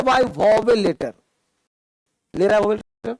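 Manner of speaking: very slow, word by word dictation. Shown as a demonstration. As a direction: spell it out slowly so they can write. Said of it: "dictating rule for students to write". (धीमे-धीमे बोलकर लिखवाना)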